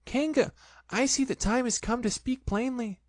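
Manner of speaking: high, trying-to-be-brave voice; a small pig speaking up bravely; brave Piglet. A narrator's reading. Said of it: "in as brave a voice as he could".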